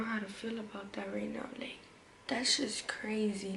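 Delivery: unvoiced, hushed disbelief; quiet shaken whisper; shocked whisper. Real, not acted. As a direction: whisper it like you can't believe it.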